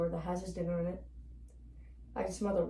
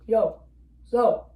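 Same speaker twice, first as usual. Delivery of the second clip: loud, high call of greeting; familiar cry; 3 semitones higher, 13 dB louder than usual. A narrator's reading. Owner calling his dog on coming home, loud cry. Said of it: "his familiar cry".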